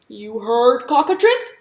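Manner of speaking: toad croaking a loud command from hiding; burped out at Cockatrice; toad bellowing. A narrator's reading. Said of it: "From there Toad burped".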